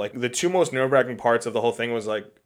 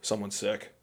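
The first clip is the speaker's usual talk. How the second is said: grave understatement; 2 semitones lower, 9 dB quieter, slower than usual. Retold or imitated. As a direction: replay a botched confession almost under your breath. Retelling his botched proposal opening.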